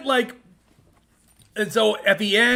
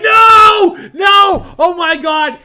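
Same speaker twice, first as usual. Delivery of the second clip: yelling no in panic, much higher and louder; shouted disbelief; 10 semitones higher, 12 dB louder, much slower than usual. Retold or imitated. Fan reliving a match, shouting at the near fall. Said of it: "and I'd be like".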